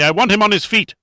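frenzied demand, pitched high and loud; insane yell. A narrator's reading. Rondheim demands guards stand victim up, insane yell. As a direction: yell the order wildly. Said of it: "Rondheim yelled insanely".

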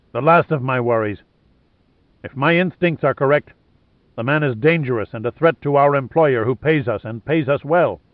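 mildly forceful, sure of his judgement; commanding Lohan. Acted. Dismissing his man's worry over the radio, mildly commanding.

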